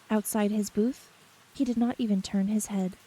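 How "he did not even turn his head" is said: ordinary delivery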